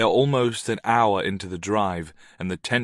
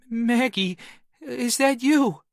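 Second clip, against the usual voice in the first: put-on high, small frightened child; a scared little boy asking; 14 semitones higher, 4 dB louder, slower than usual. A narrator's reading. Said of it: "small and scared".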